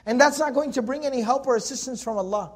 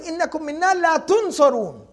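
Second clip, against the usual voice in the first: very fast flowing Arabic chant; تلاوة سريعة جدا; rapid recitation; 6 semitones higher, much faster than usual. Acted. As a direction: rattle off the verse like a recitation. Recites verse.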